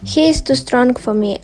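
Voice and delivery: ordinary delivery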